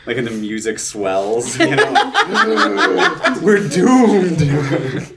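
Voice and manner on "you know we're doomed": hammed-up horror doom, laughing; laughing fake dread; mock doom. Horror movie music swells, mock doom.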